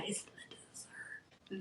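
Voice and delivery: slow, hushed whisper of the reveal. Acted. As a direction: breathe it out like a secret.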